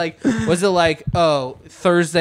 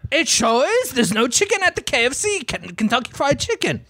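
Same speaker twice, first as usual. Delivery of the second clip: much higher, outraged mock Brit; angry but British; 8 semitones higher than usual. British Yosef demands the cop come in a much higher, outraged mock British accent. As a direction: insist it's an emergency, hammed-up. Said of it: "angry, but British".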